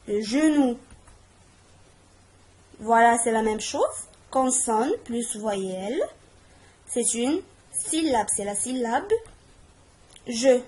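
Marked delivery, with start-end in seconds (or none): none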